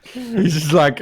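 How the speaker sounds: ordinary delivery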